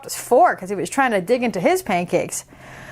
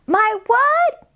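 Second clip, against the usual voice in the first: put-on high, fast chatty little girl; 9 semitones higher than usual. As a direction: rattle it off like an excited kid. Decibella surprised.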